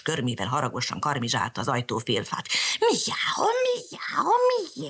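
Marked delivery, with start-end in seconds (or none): 2.8-4.9 high meowing; cat meow. Acted. Angry cat meowing at Mariska. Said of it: "azt miákolta".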